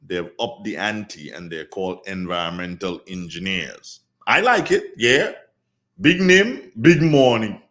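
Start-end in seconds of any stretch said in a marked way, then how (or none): 4.3-7.6 loud, high burst of approval; excited. Real, not acted. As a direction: cheer it on, big and bright. Radio host excited about new name.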